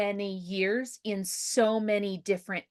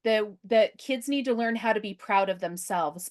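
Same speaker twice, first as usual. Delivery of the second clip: ordinary talk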